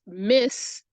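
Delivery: "miss" drawn out long; slow, stretched-out "miss"; stretched syllable. Shown as a demonstration. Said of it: "stretches out".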